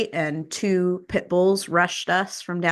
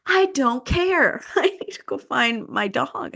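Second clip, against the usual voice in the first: retold frantic panic; 6 semitones higher than usual. Dog owner desperate to find her injured dog, frantic retelling. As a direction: re-enact being frantic, pitch leaping up. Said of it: "I'm, like, hyperventilating".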